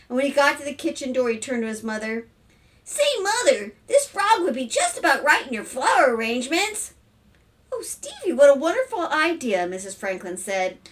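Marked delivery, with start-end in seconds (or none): 2.8-6.9 put-on high, louder child voice; coaxing little boy. A narrator's reading. Son turns to mother with frog, coaxing child. 7.7-9.6 high lilt growing louder; delighted mother. A narrator's reading. Mother praises son's idea, delighted.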